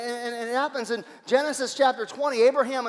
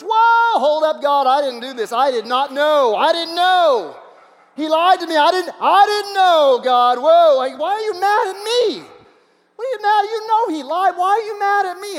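high, loud, frantic pleading; a caught man protesting his innocence in a high, strained voice; panicked protest; 9 semitones higher, 11 dB louder than usual. Acted. Abimelech pleads with God in the dream, high and panicked.

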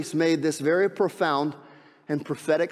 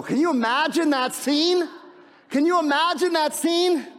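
excited wonder; 11 semitones higher, 3 dB louder than usual. Real, not acted. Preacher marvels at the miracle.

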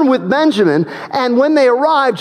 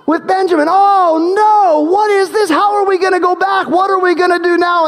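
panicked dismay; 9 semitones higher than usual. Acted. Brothers panicking at discovery.

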